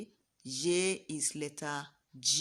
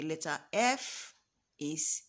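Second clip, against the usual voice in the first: usual speaking voice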